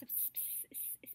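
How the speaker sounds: repeated S- as a bare voiceless hiss; hissing S's. Shown as a demonstration. Coach shows how tough S's get, hissed S-. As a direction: stutter out short hissed S sounds. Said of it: "what I mean by tough is".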